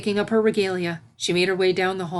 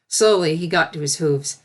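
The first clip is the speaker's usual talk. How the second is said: plain reading voice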